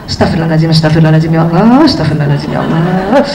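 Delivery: a mother praying under her breath for patience; pleading prayer. Shown as a demonstration. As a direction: murmur istighfar to calm yourself down. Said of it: "ibu mundur dulu sedikit".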